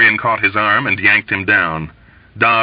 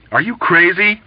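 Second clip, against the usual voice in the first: louder and higher, openly incredulous; disbelieving exclaim; 10 semitones higher, 5 dB louder than usual. A narrator's reading. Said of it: "Dobbs exclaimed in disbelief".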